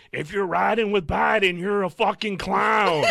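high, loud and drawn-out; mocking scream. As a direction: yell it slowly out of a car window, half laughing. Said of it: "and screams".

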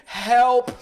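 high, loud re-enacted cry for help; screaming for help. Man in pain calling his housemate, acted scream. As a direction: call out loudly as if to another room. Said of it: "screaming in pain, hoping that someone would fucking hear me".

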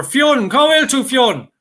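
put-on high, loud, slow call to the boy. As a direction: call the boy across the field. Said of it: "he shouted over".

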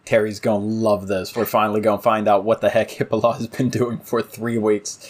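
ordinary delivery

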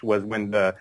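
normal talking voice

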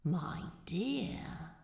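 hushed, wondering whisper; inner whisper. A narrator's reading. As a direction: whisper it as a private thought. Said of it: "thought Crowley".

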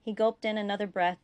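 plain reading voice

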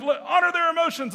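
high, loud plea; pleading spouse. Acted. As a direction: push back on the scolding parent. Acted husband-wife argument.

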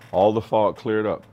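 normal talking voice

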